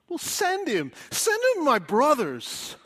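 high, loud, pleading shout; crying out. Acted. Nameless man in hell pleads for his brothers, crying out. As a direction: cry out urgently on someone else's behalf. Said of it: "just cried out".

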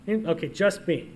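usual speaking voice

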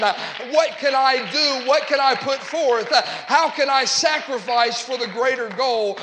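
loud, insistent pulpit appeal; impassioned preaching. Real, not acted. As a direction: preach each question harder, pressing the congregation. Preaches rhetorical questions to congregation.

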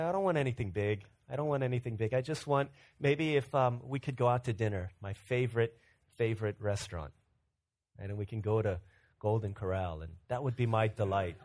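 hint of a modest wife asking for little; wife's wishes. Wife voiced in birthday story.

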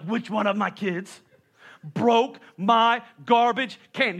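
slow, stretched-out syllables; drawn-out blaming. Retold or imitated. Dad blaming the kids over the can, slow and stretched. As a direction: stretch every vowel out long. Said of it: "that's how everything is prolongated".

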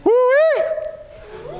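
high joyful whoop; excited whoop. Real, not acted. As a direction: let out a delighted holler. Preacher's excited exclamation.

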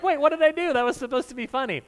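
high-pitched, taken-aback objection, acted out; mock bewildered protest. Joker to offended partner after sarcasm backfires, mock bewildered. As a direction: play someone baffled that the joke backfired.

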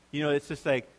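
ordinary talk

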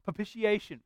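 "Propitiation" rattled off quickly and confidently in one go; said fast. Shown as a demonstration. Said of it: "You gotta say it fast".

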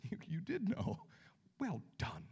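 an aside whispered to the congregation; hushed aside. Real, not acted. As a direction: whisper it, impressed. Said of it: "unvoiced whisper".

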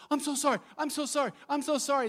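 high, flustered repeated apology; freaking out. Retold or imitated. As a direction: imitate a frantic wife apologizing over and over. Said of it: "My wife is freaking out".

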